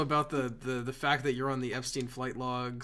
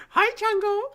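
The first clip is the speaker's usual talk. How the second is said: silly high greeting; 8 dB louder than usual. Pretend.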